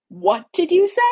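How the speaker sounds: loud, high, outraged question; indignant challenge. A narrator's reading. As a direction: demand it in disbelief. Heroine challenges his muttered remark.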